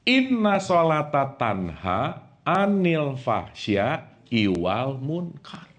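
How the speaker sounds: slow, drawn-out Arabic recitation; slow chanted verse; Quran recitation. Acted. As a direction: intone the verse slowly and reverently.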